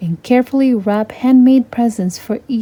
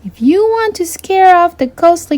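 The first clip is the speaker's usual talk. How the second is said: high, youthful princess voice, a little wary; a sheltered girl recalling spooky Christmases; lightly voiced young princess; Rapunzel's voice; 9 semitones higher, 4 dB louder than usual. Rapunzel's voice in a read story.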